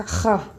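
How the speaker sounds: ra with the R from the back of the throat; guttural R. Shown as a demonstration. Teacher demonstrates the throaty double R. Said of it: "o som ele sai lá do fundo da minha garganta".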